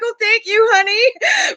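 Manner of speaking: very high, doting mom voice; re-enacted squeal of gratitude; gushing thanks. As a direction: coo it to a child with exaggerated delight. Retold thanks to son for gift.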